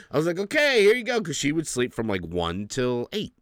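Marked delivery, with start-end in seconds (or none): 0.4-1.2 high and bright, overly cheerful; chirpy handoff. Retold or imitated. Cheerfully handing the baby to his wife, high and bright. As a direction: sing it out like a chipper dad.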